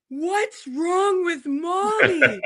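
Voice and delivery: put-on high, slow little-boy voice, cracking into laughter; son's worried question. Mother imitating her six-year-old son, laughing. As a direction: imitate a small worried child while laughing. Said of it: "and Asher goes".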